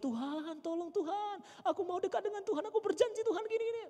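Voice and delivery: high, pleading mock prayer, voice climbing; desperate begging prayer. Preacher mimics needy believers begging God, high and pleading. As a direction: beg God like a drowning man.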